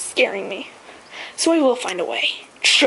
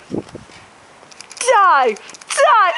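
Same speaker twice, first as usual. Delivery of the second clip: yelling; 6 semitones higher, 4 dB louder than usual. Real, not acted. Spraying the bird.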